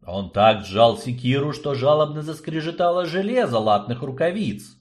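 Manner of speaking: plain reading voice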